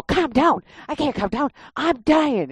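high, frantic protest; retold panic. Protesting to the nurse that she is dying, high and frantic. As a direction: throw the words back, desperate.

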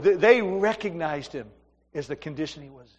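usual speaking voice

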